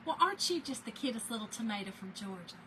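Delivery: a sugary, backhanded Southern belle, lightly voiced; sweet on top, a dig underneath, said softly; imitating future mother-in-law. Mother-in-law sizing up her son's new girlfriend, backhanded sweetness. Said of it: "and she did this".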